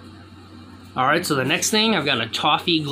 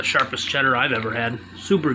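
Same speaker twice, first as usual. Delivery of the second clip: speaking with mouth full; chewing behind hand; 3 semitones lower than usual. Real, not acted. Seen 2: Fist covers mouth while chewing cheese, from -5.0 through 3.7.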